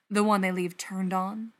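usual speaking voice

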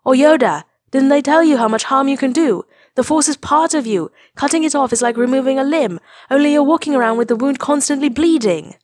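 high, loud frantic pleading; panicked. A narrator's reading. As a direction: rush it out in alarm. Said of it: "voice high-pitched in panic".

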